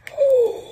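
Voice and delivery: high, loud gasp; sharp gasp of surprise; delighted gasp. Real, not acted. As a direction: gasp at a lovely find. Delighted surprise opening the box.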